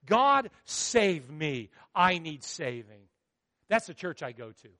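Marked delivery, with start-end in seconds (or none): 0.0-3.1 very slow, drawn-out "God, save me."; slow heartfelt plea. Acted. Preacher answering people at the pool with a slow plea. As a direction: stretch every word as a prayer. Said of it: "all I could think of is".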